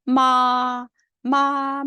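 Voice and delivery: 마 high, long and level; first tone. Shown as a demonstration. Teacher reading tones through for students, high level 마. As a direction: hold 마 steady on a high pitch. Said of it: "일 성부터 이 성".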